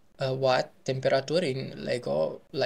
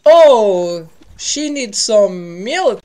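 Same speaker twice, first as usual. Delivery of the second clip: much louder, higher, slow exclaim; loud excited exclaim; 6 semitones higher, 14 dB louder, much slower than usual. Real, not acted. Player reacting to [name], loud slow excited outburst. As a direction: blurt it out in shocked delight.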